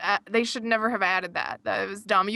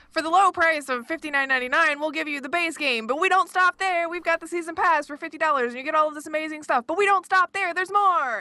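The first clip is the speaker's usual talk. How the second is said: infomercial voice; 6 semitones higher than usual. Retold or imitated. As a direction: sell it like a late-night ad. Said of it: "It sounds like an infomercial".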